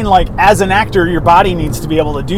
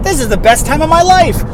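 mock cheerful; 10 semitones higher than usual. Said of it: "I was like".

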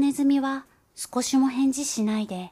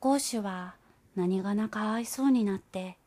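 usual speaking voice

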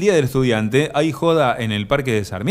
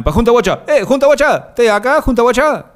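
high, loud call of surprise; excited greeting; 9 semitones higher, 5 dB louder than usual. Real, not acted. Radio host greeting a listener who just joined, high excited shout. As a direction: hail them loudly, pitch jumping way up.

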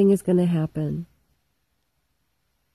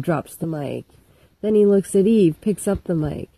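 usual speaking voice

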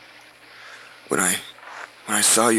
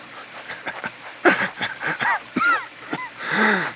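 bitter laugh. Acted.

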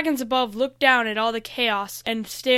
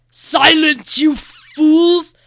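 loud, raised villain's shout; angry yell; 5 semitones higher, 12 dB louder, much slower than usual. A narrator's reading. Lane silencing the other dragons, loud angry yell. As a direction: silence them with a furious yell. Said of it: "yelled Lane".

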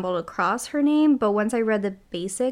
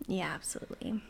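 whisper; 2 semitones lower, 12 dB quieter than usual. Real, not acted. Whispered agreement.

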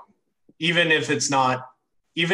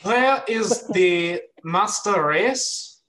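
slow, higher hammed-up German villain; bad German accent; 6 semitones higher, 3 dB louder, much slower than usual. Mocking a villain for the podcast hosts, badly faked German accent. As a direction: drag it out in a mock German accent. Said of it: "that's not a German accent".

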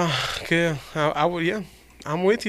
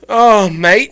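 louder, higher mock British; attempted British accent; 5 semitones higher, 7 dB louder than usual. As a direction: have a go at British. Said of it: "A British sentence".

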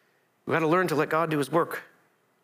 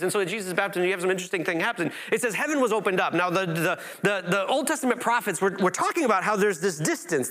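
ordinary delivery

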